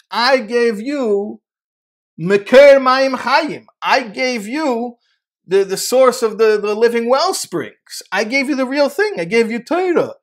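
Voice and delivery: high, loud and slow, booming reproach; rebuking God. Acted. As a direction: thunder it down as God rebuking his people.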